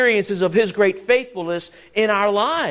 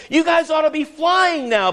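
mock rebuke; 7 semitones higher than usual. Preacher voicing Paul's rebuke.

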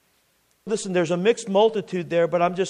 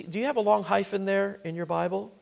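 normal talking voice

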